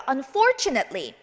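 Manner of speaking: slow model "Unfortunately", stress on FOR; short "net" ending. Shown as a demonstration. Teacher modelling for repetition, slow and clear. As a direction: say "Unfortunately" slowly as un-FOR-chu-net-lee. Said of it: "한번 읽어볼게요".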